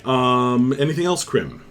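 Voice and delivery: ordinary talk, nothing marked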